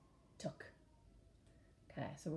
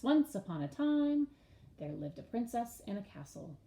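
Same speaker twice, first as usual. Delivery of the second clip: storybook opening; 4 semitones higher than usual. Shown as a demonstration. Teacher opening a fairy tale for the class. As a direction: announce it like a storybook. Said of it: "teacher gives storybook example".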